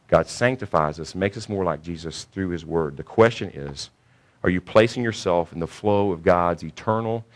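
normal talking voice

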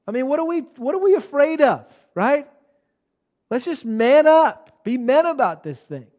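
loud, high-pitched preaching challenge; rally the room, punching it hard; rousing exhortation. Real, not acted. Preacher urging congregation to man up with the gospel, rousing.

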